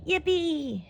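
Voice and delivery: high, joyful yippee; happy cheer. Acted. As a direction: cheer with delight. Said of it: "he cried out happily".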